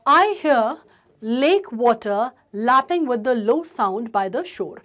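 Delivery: slow recitation stressing the l sounds; poem read with stress. Shown as a demonstration. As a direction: lean on "lake" and "lapping", drawn out. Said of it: "ठीक है।". (कविता धीमे-धीमे, "lake" और "lapping" पर ज़ोर)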